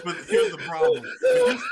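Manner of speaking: very high, loud, laughing. Real, not acted. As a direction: burst out laughing mid-sentence. Laughing restart.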